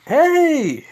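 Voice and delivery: high, loud drawn-out hail; calling out. Acted. Answering the girl on the cart, calling out. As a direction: shout a long happy-to-be-seen greeting.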